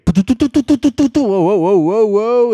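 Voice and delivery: loud rising whoas as the stand sinks; surprised outburst at sagging stand; alarmed whoa. Real, not acted. Stand slowly sinking.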